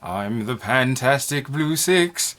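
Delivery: slow, drawn-out spooky ghost voice. Pretend. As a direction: moan it out like a haunting spirit. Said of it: "I'm doing a ghost voice".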